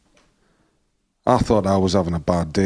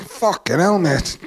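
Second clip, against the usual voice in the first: much higher, shocked curse; stunned exclamation; 8 semitones higher, slower than usual. Retold or imitated. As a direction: blurt it out in disbelief. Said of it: "I was like".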